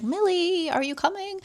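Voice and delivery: lightly voiced husband calling his wife. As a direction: call her name brightly from afar. [name] calls out interrupting.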